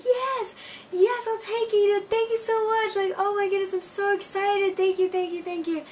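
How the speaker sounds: very high, squealing rush of gratitude; flipped-out excitement. Retold or imitated. New teacher accepting the job by phone, squealing with excitement. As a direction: gush in a high, strained squeal. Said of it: "flipped out on the phone".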